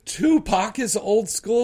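high and loud disbelief; can't believe what she heard; incredulous. Real, not acted. Incredulous echo of quoted girl.

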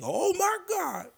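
very high, loud, slow falsetto squeal; shrieking falsetto exclamation; Laughing Lenny impression. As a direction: squeal it out like a giddy comic. Repeating the comic's catchphrase.

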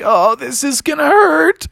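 high, rising mock dread; cartoon hero's rising alarm; dreading impact. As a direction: brace for a crash in a high voice. Bubby dreads the second bounce.

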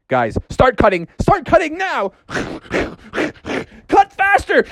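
urgent high father. Acted. Froggerson urges cutting.

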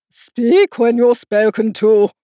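sharp rebuke. Acted. Red Queen rebuking Alice, sharp and slow. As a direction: snap the order down at her. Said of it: "the Queen sharply interrupted her".

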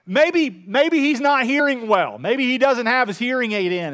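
loud, high-pitched taunting; jeering mock suggestion. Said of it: "Elijah began mocking them".

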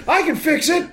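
eager would-be hero, very high put-on voice; high comic cry offering help; Middle-aged Man. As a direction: burst out in a hammed-up sketch-character voice. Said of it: "He's the guy that middle-aged man".

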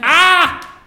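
loud, high "Ah!"; startled yell. Acted. Startled by news of a carrier pigeon, sudden yell.

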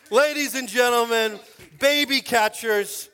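loud, high showman intro; slow, grand hype; emcee announcement. Pretend. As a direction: present them like a ring announcer.

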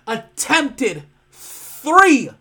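"attempted three" very slow and loud, THREE dropping hard; stress on three. Real, not acted. Mocking the three pass attempts.

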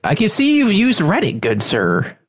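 high-pitched silly voice dropping at the end; playful mock-formal squeal, falling away. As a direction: put on a silly Reddit-gentleman voice. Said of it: "when I'm doing that voice".

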